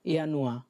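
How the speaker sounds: ইয়ানুয়া said ya-noo-ah; German Januar. Shown as a demonstration. Teacher repeats ইয়ানুয়া for the class to copy. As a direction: say ইয়ানুয়া with a Y sound. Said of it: "এই উচ্চারণ প্রনাউন্সিয়েশনগুলো আরেকবার বলি".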